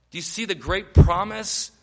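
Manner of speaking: ordinary delivery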